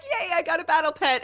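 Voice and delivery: high, gleeful little cheer; excited squeal. Retold or imitated. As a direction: squeal it like a delighted kid. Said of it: "being like".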